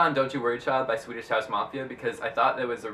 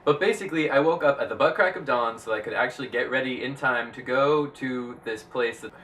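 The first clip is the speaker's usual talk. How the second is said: ordinary talk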